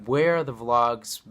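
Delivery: ordinary delivery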